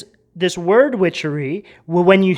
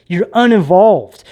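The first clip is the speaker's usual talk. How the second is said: ordinary talk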